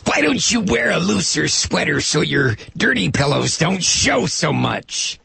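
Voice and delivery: high slow sneering mock voice with a squeal; mocking Palumbo. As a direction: leer it like a bigoted lawmaker. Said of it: "Peter Palumbo".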